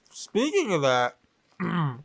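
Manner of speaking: talking through a mouthful of popcorn; munching popcorn. Real, not acted. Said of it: "I'm eating popcorn right now".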